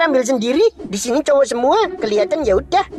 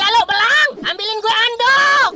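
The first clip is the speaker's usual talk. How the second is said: calling out; 8 semitones higher than usual. Real, not acted. Berteriak minta handuk. (teriak lambat minta handuk)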